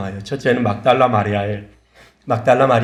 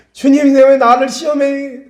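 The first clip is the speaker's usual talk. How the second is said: loud, high, drawn-out imitated lament; mock wailing; 12 semitones higher, 5 dB louder, slower than usual. A failed believer complains to the Lord, mock wailing. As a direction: cry out in exaggerated anguish.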